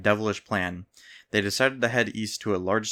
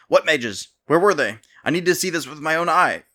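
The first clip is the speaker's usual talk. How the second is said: higher, louder, incredulous king; can't believe what he's hearing; disbelief; 7 semitones higher, 6 dB louder than usual. A narrator's reading. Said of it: "The king, in disbelief".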